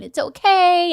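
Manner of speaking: put-on imagined nurse comforting a little girl; soothing nurse. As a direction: coo it to calm a scared kid.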